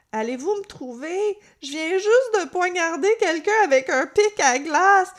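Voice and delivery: high-pitched, whiny, sobbing killer on the phone; plaintive caller. Retold or imitated. Killer calling emergency dispatch, imitated plaintive wail. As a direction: plead loudly, high and distraught, imitating the caller. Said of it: "je vais essayer de limiter la voix".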